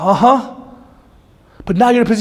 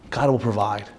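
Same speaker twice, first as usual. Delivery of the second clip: softer, lower trusting line; quiet faith; 8 semitones lower, 7 dB quieter than usual. Retold or imitated. Preacher voicing Abraham's trust, soft and low. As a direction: let it settle softly. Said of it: "No, it's".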